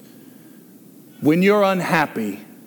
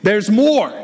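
louder, punchier there's more; infomercial pitch; 5 semitones higher, 5 dB louder than usual. Retold or imitated. Preacher repeating the infomercial line, louder sales punch. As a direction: sell it like a TV ad. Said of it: "like those infomercials".

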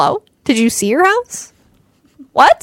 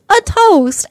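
mock toast; 10 semitones higher, 3 dB louder than usual. Father-in-law in the movie.

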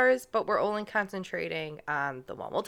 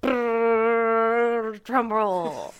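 mouth drum roll; 3 semitones higher, 3 dB louder, much slower than usual. Pretend. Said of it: "I can't do that thing with my lips".

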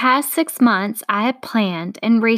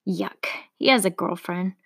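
hushed, disappointed whisper; a let-down whisper to herself; whispered aside; 2 semitones lower, 4 dB quieter than usual. Acted. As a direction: breathe it out under your breath.

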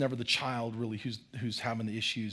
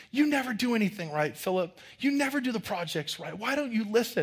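mock scolding; 8 semitones higher than usual. Kid scolding Philip.

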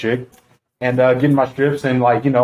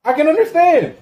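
exasperated rant; 11 semitones higher than usual. Real, not acted. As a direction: plead your case, pitched way up. Comic rant after the restaurant story.

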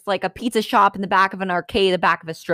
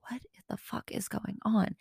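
whispered, much softer bewilderment; hushed disbelief; 3 semitones higher, 12 dB quieter than usual. Retold or imitated. Her private reaction to the shouting stranger, hushed disbelief. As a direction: whisper it to yourself, baffled.

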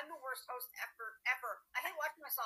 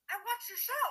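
fan's voice; 11 semitones higher, 6 dB louder than usual. Retold or imitated. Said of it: "She goes".

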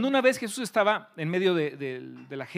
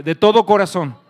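much louder, forceful call; loud declaration; 2 semitones higher, 15 dB louder than usual. Real, not acted. Preacher drilling the congregation's answer, loud declaration. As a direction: shout it for them to echo. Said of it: "Dilo fuerte".

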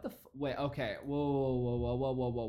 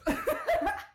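very high real laugh burst; cackling laughter; 4 dB louder than usual. Real, not acted. Host mocking speaker out of breath, high cackle. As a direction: lose it laughing.

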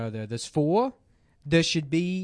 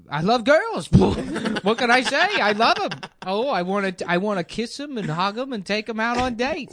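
loud, higher, hammed-up enthusiasm; excited gushing; 4 semitones higher, 4 dB louder than usual. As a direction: gush like a smitten fool. Comic gushing declaration of love for girls.

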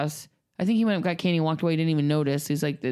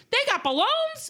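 put-on kid voice. Son reports kids got balloons.

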